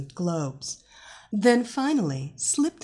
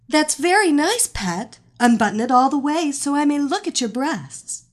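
commanding mistress; 6 semitones higher, 6 dB louder than usual. Acted. Mistress commanding her hypnotized captive.

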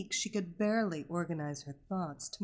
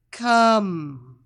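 sharp, much louder order; command; 13 dB louder than usual. A narrator's reading. Hypnotist commanding her captive, loud. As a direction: snap the single word. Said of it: "she commanded".

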